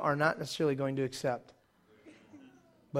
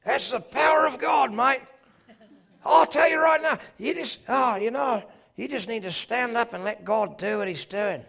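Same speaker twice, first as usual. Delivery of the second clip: higher, louder Australian accent, hammed-up; Australian preacher; 7 semitones higher, 4 dB louder than usual. Imitating Australian preacher Clark Taylor at altar, Australian accent. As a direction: boom it out like an Aussie preacher. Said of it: "he just talked like this".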